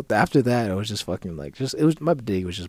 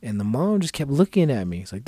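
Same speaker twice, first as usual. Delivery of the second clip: usual speaking voice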